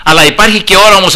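normal talking voice

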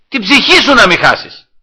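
high, loud pleading warning; urgent exhortation. Real, not acted. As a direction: cry out the warning from the pulpit. Urgent rebuttal of despairing man. (δυνατή, επιτακτική προτροπή)

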